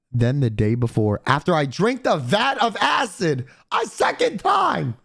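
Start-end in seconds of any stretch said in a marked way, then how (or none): 3.7-4.9 high, slow, exasperated yell; outraged yell. Retold or imitated. Telling friends about the cancelled appointment, outraged yell. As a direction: stretch it out, disbelieving.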